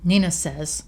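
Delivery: ordinary delivery, nothing marked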